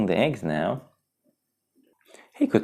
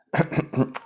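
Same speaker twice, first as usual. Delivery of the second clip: throat clearing; 10 semitones higher than usual. Acted. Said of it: "Pumbaa coughed".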